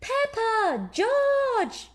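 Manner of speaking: put-on mother calling children from the house. A narrator's reading. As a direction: call across the garden. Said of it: "Mummy Pig calling from the house".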